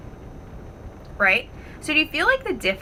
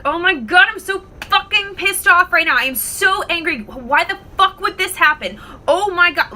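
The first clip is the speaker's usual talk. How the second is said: furious rant, higher and loud; angry freak-out; 8 semitones higher, 6 dB louder than usual. Acted. Storyteller acting out the first guy stranded on safari, furious freak-out. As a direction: blow up in rage, pitch and volume jumping. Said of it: "starts to freak out. So angry".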